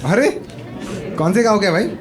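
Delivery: ordinary delivery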